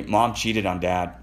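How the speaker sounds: usual speaking voice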